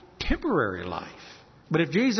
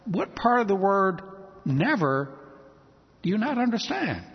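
ordinary delivery

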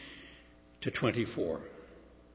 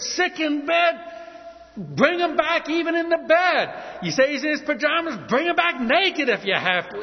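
shouted higher, furious royal outburst; preacher acting out furious King Saul; 11 semitones higher, 4 dB louder than usual. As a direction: roar the order like an enraged king. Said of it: "the king is furious".

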